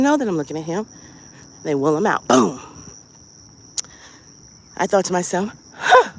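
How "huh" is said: sudden high, loud "huh"; startled huh. Retold or imitated. Patient thinking to himself, startled. Startled reaction in retold scene.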